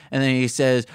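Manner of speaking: ordinary talk, nothing marked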